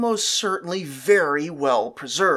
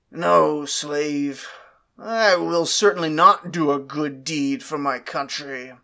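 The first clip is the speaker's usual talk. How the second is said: stern master; 4 semitones lower than usual. Acted. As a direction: refuse flatly like a proud lord. Master reverses himself in dialogue.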